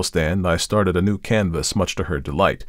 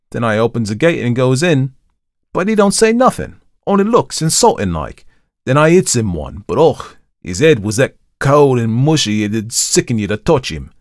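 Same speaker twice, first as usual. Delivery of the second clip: louder, higher Cockney storyteller; Thomas; 6 semitones higher, 6 dB louder than usual. A narrator's reading. Thomas recounts meeting the watchman, in lively Cockney. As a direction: tell the tale in animated Cockney. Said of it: "had not only modified Thomas's Cockney dialect".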